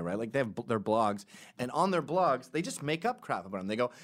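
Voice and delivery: usual speaking voice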